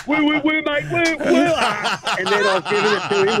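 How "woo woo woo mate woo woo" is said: higher pitched warning calls of woo; mock alarmed woo-ing at the driver; retold calling out. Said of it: "and I'm going".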